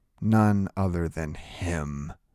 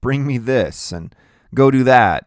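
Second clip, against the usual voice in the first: high, loud little commands; bossy, raised-voice orders; bossy ordering; 4 semitones higher, 8 dB louder than usual. A narrator's reading. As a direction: order a friend about. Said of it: "ordering me around".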